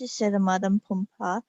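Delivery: ordinary delivery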